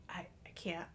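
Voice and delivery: whispered in despair. Retold or imitated. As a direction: breathe it out, voiceless. Overwhelmed by own smile.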